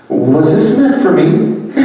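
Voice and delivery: high, laughing through the question; amused disbelief, laughing as she asks; laughing quote. Retold or imitated. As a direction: let a laugh break into the retold question. Retelling a surprised reply.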